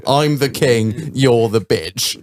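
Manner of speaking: slow, drawn-out mock-royal put-down; mock lordly king. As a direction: lord it over them, slowly. Mocking the legend lording over virgins.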